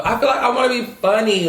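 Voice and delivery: ordinary talk, nothing marked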